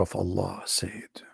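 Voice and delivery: hushed, dramatic whisper. Acted. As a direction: whisper it like a secret. Hushed intro to hadith.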